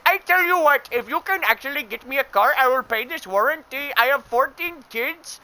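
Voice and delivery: high-pitched, hammed-up exasperated rant; put-on ranting caller. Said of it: "a thirty minute rant like that".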